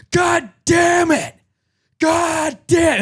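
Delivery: very high, drawn-out mock scream; imitated screaming. Imitating a man startled by his dog, high screaming. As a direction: scream it like an outraged old man, stretching each word. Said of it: "he was just screaming".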